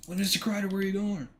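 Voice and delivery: coach's voice. Retold or imitated. Head coach stopping him.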